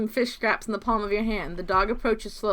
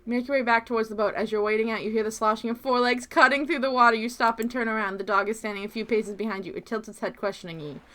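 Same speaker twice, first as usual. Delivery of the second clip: soft, quick narration read; reading game text; 3 dB quieter, faster than usual. Acted. Streamer reading the dog scene narration, softly and quickly. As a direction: murmur the story text.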